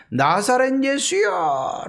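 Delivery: high, drawn-out call; crying out. Acted. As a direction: call out slowly to someone far off. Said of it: "소리를 지르죠".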